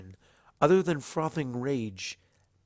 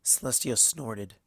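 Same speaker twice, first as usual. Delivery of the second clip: ordinary delivery, nothing marked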